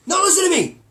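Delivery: high, loud, exasperated dad yell; hammed-up angry dad yell. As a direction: bark at the son through the door. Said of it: "My dad was like Vic DiMatteo".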